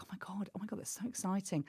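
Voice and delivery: hushed, breathless thrill; mock giddy whisper before a sold-out show; excited whisper. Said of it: "I was like".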